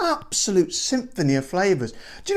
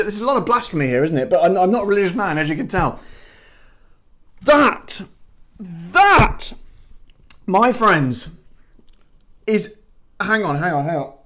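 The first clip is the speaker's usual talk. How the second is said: usual speaking voice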